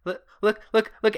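high, loud excited calling. Acted. Lake calling Elliot to look, very excited. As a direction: burst out, calling a friend over. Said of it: "And she's very excited".

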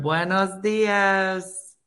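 slow Spanish accent greeting; drawn-out Spanish accent. Shown as a demonstration. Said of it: "my Spanish accent is getting better".

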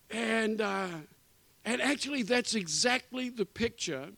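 ordinary talk, nothing marked

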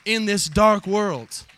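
building sermon climax; full-force preaching. Real, not acted. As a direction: preach it out over the music.

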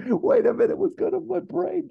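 very high squeaky disbelief; mock bewilderment. Viewer thrown by the siblings' switch, squeaky mock bewilderment. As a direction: play confusion in a squeal. Voicing the baffled viewer's whiplash.

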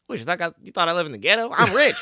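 fast, quoted comedian bit; a quick, lightly voiced Chappelle imitation; imitating Chappelle. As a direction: rattle it off like a stand-up punchline. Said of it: "And he'd be like".

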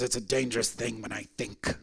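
a dying atheist tells his caretaker he'll try Jesus, whispering; frail whisper. Retold or imitated. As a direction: breathe it out like a dying man's secret. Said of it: "He says".

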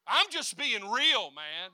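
mock the complainer's excuse. Mocks a complaining believer.

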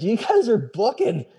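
panting words between breaths; huffing and puffing on a climb. Retold or imitated. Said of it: "I'm huffing and puffing behind the pack".

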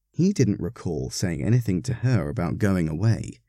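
ordinary delivery

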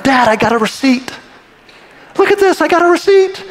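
put-on high, eager child's voice showing off; excited kid. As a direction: squeal it like a thrilled little kid.

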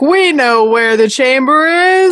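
high, slow grand declaration; dramatic entrance. Retold or imitated.

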